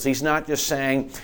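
ordinary delivery

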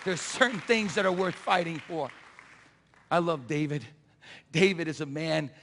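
ordinary talk

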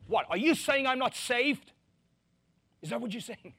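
high, loud, incredulous; mock indignant outrage; offended churchgoer. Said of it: "People go like".

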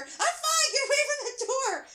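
high, loud shriek through a door; desperate scream. A narrator's reading. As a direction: shriek at him to go away. Repeated screamed order through bathroom door.

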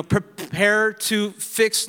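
drawn out very slow "Prepare to fix"; preacher stretching "Prepare to fix" slowly to show the wrong sense; slow mock misreading. Shown as a demonstration. Preacher slowly misreads "Prepare to fix" for the congregation. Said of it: "No, I, I want to fix".

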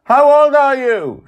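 very high, loud call down; yelling. Acted. Joy yelling down to the dreamer as the ship passes. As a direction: shout it across the distance. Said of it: "she yells".

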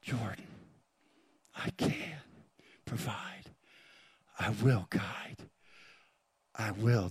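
very soft, low whisper. Acted. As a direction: whisper God's promise slowly, with long gaps. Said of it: "He's whispering".

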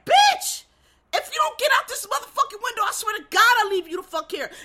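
high, loud, fed-up outburst; acted high-pitched angry shouting; exasperated yell. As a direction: explode at someone who won't move. Voicing exasperated character ordering escape.

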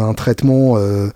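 ordinary talk

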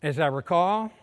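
ordinary delivery

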